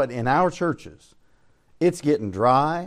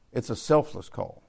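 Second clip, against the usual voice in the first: lower, softer echo of the point; quiet repeat; 4 semitones lower, 6 dB quieter than usual. Real, not acted. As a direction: let it sink in quietly.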